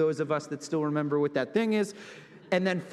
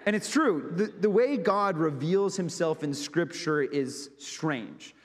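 ordinary talk

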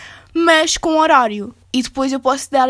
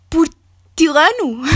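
slow, drawn-out Italian-accented portilano; italian accent portuguese; 3 semitones higher, 4 dB louder, much slower than usual. Shown as a demonstration. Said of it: "já me estou a ver a falar portilano". (português com sotaque italiano)

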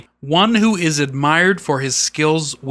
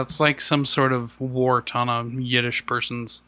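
ordinary talk

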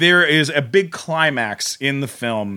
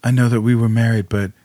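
ex-husband quote; 4 semitones lower than usual. Retold or imitated. Ex-husband voiced in retold scene.

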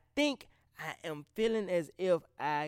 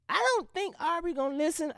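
much higher, half-mocking take; high doubtful voice; 13 semitones higher, 5 dB louder than usual. Shown as a demonstration. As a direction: voice the doubting part of yourself up high. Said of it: "a piece of me is just like".

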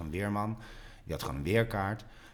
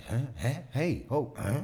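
mock bewildered; 7 semitones higher, slower than usual. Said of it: "dat je ook denkt".

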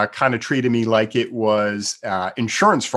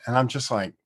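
ordinary talk, nothing marked

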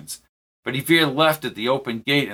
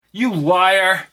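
loud, higher furious accusation; angry outburst; 6 semitones higher, 5 dB louder than usual. Acted. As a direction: hurl the accusation at him. Peter accuses Ricardo.

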